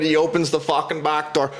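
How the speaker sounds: normal talking voice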